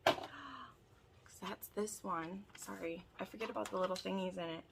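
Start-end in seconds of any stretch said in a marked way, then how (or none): none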